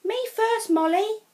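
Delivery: a classmate pushing in first, high and loud; Nicole. A narrator's reading. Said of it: "said Nicole".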